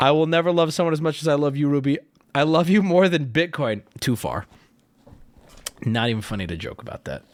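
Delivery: ordinary delivery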